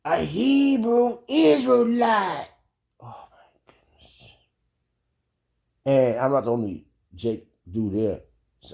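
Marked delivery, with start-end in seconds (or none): 0.0-2.5 high, slow drawn-out yell across the site. Retold or imitated. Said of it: "he yells out". 3.0-4.3 shocked whisper; stunned whispered aside. Real, not acted. Reacting to being called out.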